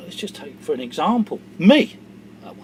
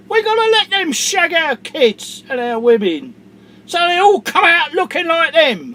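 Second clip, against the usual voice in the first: much higher, loud sneering whine; mocking government voice; 10 semitones higher, 9 dB louder than usual. Angry vlogger mocks politicians, high sneering voice. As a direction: sneer the official line back at them.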